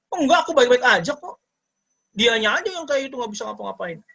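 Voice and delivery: high, breezy mock denial. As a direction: brush it off like nothing's wrong. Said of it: "nah, denial". (meniru orang yang menyangkal dengan nada tinggi)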